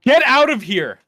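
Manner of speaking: high, loud cry of disbelief; shocked disbelief. Real, not acted. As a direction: blurt it out, floored by the news. Hears someone used his name.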